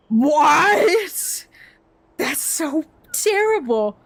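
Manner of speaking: high, drawn-out appalled outburst; shocked disbelief. Real, not acted. Reacting to a museum selling bomb magnets, shocked disbelief. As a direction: blurt it out high, then stretch the words.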